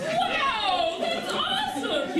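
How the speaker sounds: high, laughing praise; delighted praise. Real, not acted. Teacher praising the child in the pink jacket, high and laughing.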